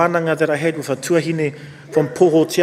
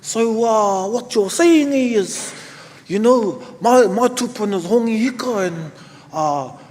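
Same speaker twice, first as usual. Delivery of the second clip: put-on higher and louder, a proud young boy working it out; cheeky boy voice; 7 semitones higher, 5 dB louder than usual. Ten-year-old boy tells his teacher he is his ancestor, higher and louder. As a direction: play the excited kid realising he is his ancestor. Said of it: "He goes".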